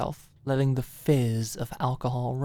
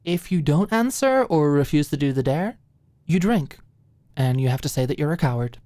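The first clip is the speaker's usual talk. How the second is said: louder, eager rules; over-eager; 6 dB louder than usual. Acted. Alicia eagerly setting the drinking rules for Maud. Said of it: "clearly over-eager".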